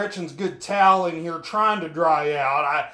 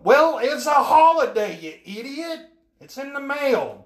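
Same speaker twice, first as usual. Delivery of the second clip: mock exasperation; 6 semitones higher than usual. Telling off the pushy bill collector, high mock exasperation. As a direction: scold the caller like he's dense.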